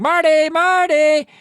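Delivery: high, loud, slow call with a far-off timbre. Retold or imitated. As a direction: call his name into the dark. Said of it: "she reaches out and she's like".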